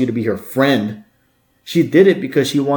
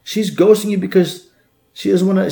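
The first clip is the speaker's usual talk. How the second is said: ordinary delivery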